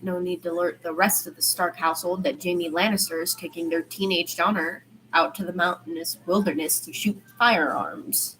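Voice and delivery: ordinary delivery, nothing marked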